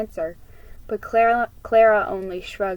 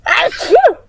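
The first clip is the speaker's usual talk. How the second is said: another sharp, loud sneeze; 12 semitones higher, 12 dB louder than usual. Real, not acted. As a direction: sneeze hard and high. Second sneeze mid-sentence.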